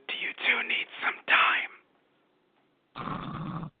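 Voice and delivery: soft voiceless aside; the wind teasing quietly; whispering wind. Retold or imitated. The wind asking Terry and the tree, hushed whisper.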